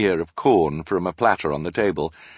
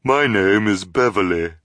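a lightly lowered put-on voice; girl faking a deeper voice; low disguised voice; 4 dB louder than usual. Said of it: "in her low, disguised voice".

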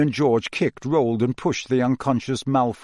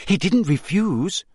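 gasped; 6 semitones higher than usual. A narrator's reading. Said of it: "gasped Hermione".

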